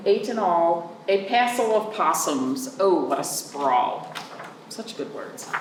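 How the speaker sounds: plain reading voice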